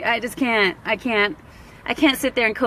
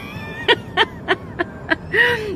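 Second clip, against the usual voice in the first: high breathless laughter; 12 semitones higher than usual. Real, not acted. Laughing fit.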